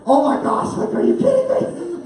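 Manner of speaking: high, squealing, laughing amazement; overjoyed disbelief. Retold or imitated. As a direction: gush in comic relief. Overjoyed movie has not started.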